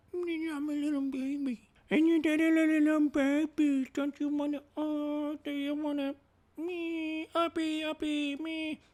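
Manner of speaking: put-on very high, soft, lisping baby voice; baby talk. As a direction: coo like a toddler wanting to be picked up. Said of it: "it's so weird to be like".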